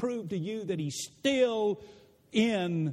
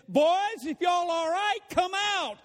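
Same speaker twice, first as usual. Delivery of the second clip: high, loud call into the furnace; the king shouting to the boys in the fire, boys come out; 9 semitones higher, 3 dB louder than usual. Acted. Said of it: "And the king said".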